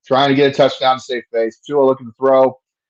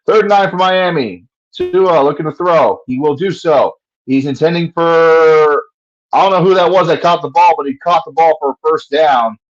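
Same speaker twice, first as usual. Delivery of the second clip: a hint of a live game call, slightly raised; play-by-play call; 4 semitones higher, 4 dB louder than usual.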